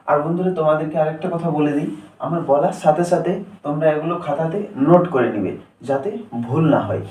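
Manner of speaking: ordinary talk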